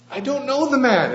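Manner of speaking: high, loud, frantic denial; angry denial. Acted. Peter to the servant girl, a high loud frantic denial. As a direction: fling the denial out, cornered and desperate. Peter denying Jesus a third time.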